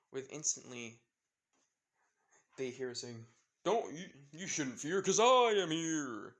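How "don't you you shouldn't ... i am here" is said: loud, high heroic proclamation; triumphant rescuer's call, drawn out; heroic arrival. Acted. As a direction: boom it out, stretching the final words. Acted hero announces his arrival.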